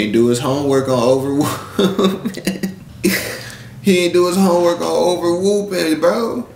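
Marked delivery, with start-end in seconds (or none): 2.4-3.8 a quick unvoiced chuckle; breathy laugh. Real, not acted. Laughs at the phrase. 3.8-6.5 higher, incredulous mocking echo of the phrase; mocking repeat. Real, not acted.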